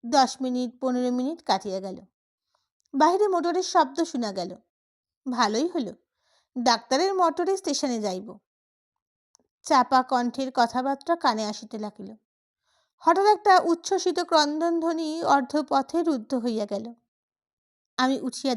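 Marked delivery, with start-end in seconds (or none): none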